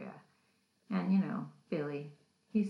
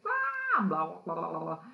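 mock freak-out; 2 semitones higher, 5 dB louder than usual. Aaron freaks out at shoulder tap, mock panicked scream. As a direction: imitate a panicked yelp, hammed-up. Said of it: "he totally freaks out".

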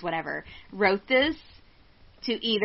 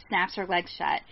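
ordinary talk

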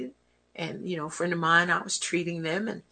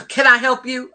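impatient cashier; 6 semitones higher, 6 dB louder than usual. Retold or imitated. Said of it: "I was like".

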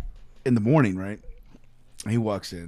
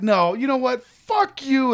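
high, raised-voice retold defiance; defiant anger; 11 semitones higher, 4 dB louder, slower than usual. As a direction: square up for a fight. Retold quarrel with doctor.

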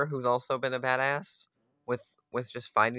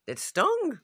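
much higher, louder "stung"; pained high cry of "stung"; sharp high yelp; 14 semitones higher, 3 dB louder than usual. Real, not acted. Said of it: "oh, it stung".

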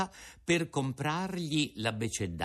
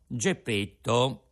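ordinary delivery

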